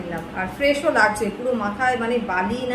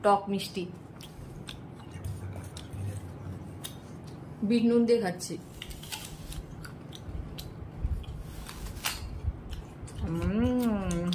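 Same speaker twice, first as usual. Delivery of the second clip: talking softly; 4 semitones lower, 11 dB quieter than usual. Real, not acted. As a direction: keep it low and soft, almost whispering. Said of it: "তাই আস্তে আস্তে কথা বলছি".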